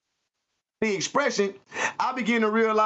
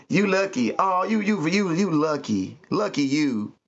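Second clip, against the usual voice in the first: sarcastic; 4 semitones lower than usual. Retold or imitated. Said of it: "They be so sarcastic with it".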